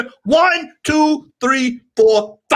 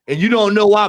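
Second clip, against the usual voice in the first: ordinary talk, nothing marked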